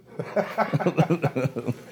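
a bright burst of laughter, pitched high. Real, not acted. Guest laughing at his childhood snowball fights, real laugh. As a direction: laugh at your own story.

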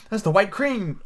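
high-pitched excited Alice; eager cry. A narrator's reading. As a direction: point it out eagerly. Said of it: "she cried, pointing eagerly".

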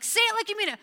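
high, loud insistence; exasperated parent. Retold or imitated. Parent repeating the demand to the child, high and loud. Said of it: "and like".

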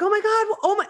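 high, loud imitation of a panicked commenter; imitating a freaked-out follower; mock panic. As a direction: squeal it in hammed-up alarm. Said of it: "explain to those of you that aren't panicked".

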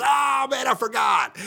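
high-pitched mock dismay; forgetful waiter. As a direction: cry it out as a waiter who just remembered. Imitates forgetful waiter's apology.

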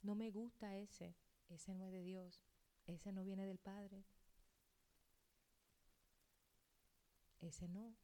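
soft, slow, grave warning; quiet warning. Retold or imitated. Pastor quietly warning Elena about a suitor, soft and slow. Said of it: "yo sí te digo, "Elena".

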